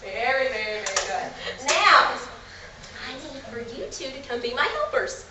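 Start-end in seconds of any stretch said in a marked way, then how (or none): 1.7-5.2 put-on kids show host. Shown as a demonstration. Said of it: "talking to you once again like the normal age".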